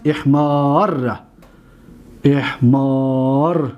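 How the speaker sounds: احمار said very slowly, ر doubled and held; slow conjugation drill. Shown as a demonstration. Teacher conjugates for students, slow drill. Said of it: "فعل ماضیش چی است".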